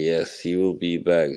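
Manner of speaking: ordinary delivery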